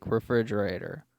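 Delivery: very loud, close on the mic; right into the mic. Shown as a demonstration. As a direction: lean in and say it into the mic. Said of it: "Directly into the mic".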